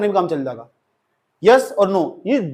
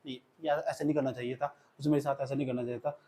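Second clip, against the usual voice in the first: much softer, lower, sorrowful murmur; soft hurt lament; 7 semitones lower, 16 dB quieter than usual. Shown as a demonstration. Teacher showing how a betrayed lover talks, softly hurt. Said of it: "ऐसे नहीं बोलना".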